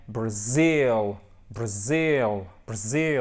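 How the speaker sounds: BRAZIL with a long, swelling ZIL; exaggerated teacher's "bruh-ZIIL"; marked final stress. Shown as a demonstration. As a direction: stretch the second syllable of "Brazil". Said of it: "c'est vraiment très marqué".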